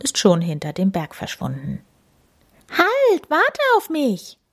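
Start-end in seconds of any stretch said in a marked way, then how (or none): 2.7-4.4 high squeaky call after someone. A narrator's reading. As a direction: call out high as he runs away. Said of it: "ruft Tami".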